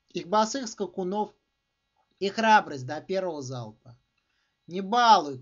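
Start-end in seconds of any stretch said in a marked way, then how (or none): 4.7-5.4 short, loud warning cry; shout. A narrator's reading. Traveller warning off shepherds with knives, shouted. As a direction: call it out sharply at full voice. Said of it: "крикнул он по-испански".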